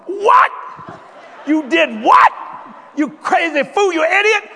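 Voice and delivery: shrieking high, very loud disbelief; wife's outraged yell. Retold or imitated. Wife scolding her husband for the stretch-mark remark, loud and high. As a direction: blast him like a furious wife. Wife yells at him, retold.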